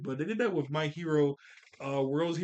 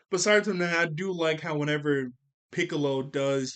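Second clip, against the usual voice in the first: ordinary talk, nothing marked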